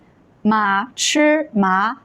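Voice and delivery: slow Mandarin demo, tones drawn out; measured teacher's read-after-me delivery; model reading. Shown as a demonstration. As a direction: say each syllable separately for repetition. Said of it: "I'll read it first and you can repeat".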